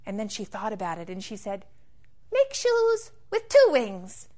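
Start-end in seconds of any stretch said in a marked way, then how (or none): 2.3-4.3 high bird voice. Acted. Bird character speaks.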